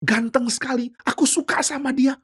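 gasp, then high swooning admiration; high-pitched infatuated gushing; smitten delight. Acted. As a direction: play a woman thrilled by a handsome man. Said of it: "istrinya Potifar senang sekali lihat Yusuf".

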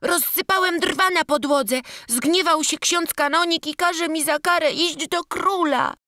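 put-on high, timid childlike apology; a scared little boy stammering out his excuse. Boy complaining to Paweł.

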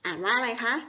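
ordinary talk

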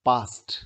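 "पास्ट" in one syllable, ending "st". Shown as a demonstration. Teacher models passed after s, ending pronounced "t". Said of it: "इसमें स है".

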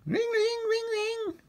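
very high falsetto ringing; phone ringing imitation. A narrator's reading. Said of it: "Then her phone rang".

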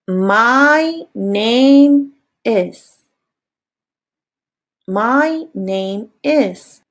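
very slow, word by word; drawn out for learners to copy; slow model phrase. Shown as a demonstration. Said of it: "Listen and repeat".

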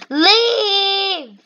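high, long drawn-out plea-order; exasperated wail. Real, not acted. Gamer telling a line blocker to go, exasperated wail. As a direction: stretch the word into a high wail. Yelling at player to leave.

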